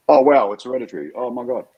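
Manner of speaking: high, loud burst of retold panic; panicked gasp at the news; sudden panic. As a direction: relive the moment of alarm, loud and high. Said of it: "I immediately went into".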